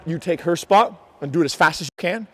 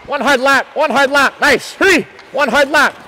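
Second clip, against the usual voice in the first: halftime pep speech; 4 semitones higher than usual. Real, not acted. Coach calling players in.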